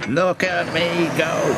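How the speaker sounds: high, drawn-out boast; boastful. A narrator's reading. Diesel races about on new fuel, boastful. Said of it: "he boasted".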